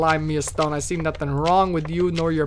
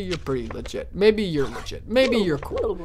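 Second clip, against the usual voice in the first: normal talking voice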